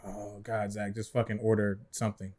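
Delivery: soft, fed-up low grumble; a waiter muttering at a customer, fed up; mock exasperated mutter. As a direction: sigh it out quietly, annoyed.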